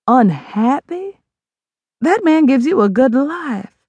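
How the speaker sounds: slow disbelieving echo; incredulous mother. Acted. Mother challenging daughter, incredulous. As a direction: throw the word back at her.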